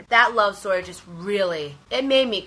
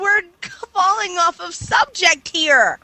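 exasperated whine; 8 semitones higher, slower than usual. Real, not acted. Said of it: "mother hen of this podcast".

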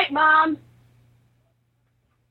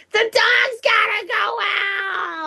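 mom's nagging yell; 7 semitones higher, much slower than usual. Retold or imitated. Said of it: "she's like".

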